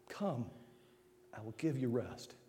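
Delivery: quiet reassuring promise; soft invitation. Acted. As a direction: speak it tenderly as Jesus. Tender quoted invitation.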